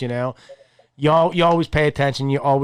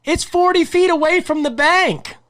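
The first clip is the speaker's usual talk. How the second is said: high, louder alarmed call; warning shout; 13 semitones higher, 4 dB louder than usual. Retold or imitated. Man on the bank warning them about open water, high alarmed shout. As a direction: shout the warning across the ice.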